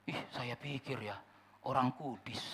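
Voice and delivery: soft, slowed sniff of disdain; hint of a sneering churchgoer; mock disappointment. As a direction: sound let down and snide.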